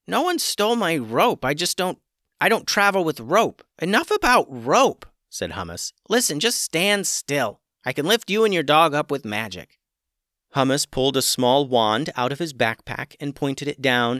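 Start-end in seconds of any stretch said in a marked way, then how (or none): 0.1-9.8 irritated chicken. Acted. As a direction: cut the rope talk short.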